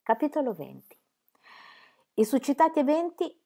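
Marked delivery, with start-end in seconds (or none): none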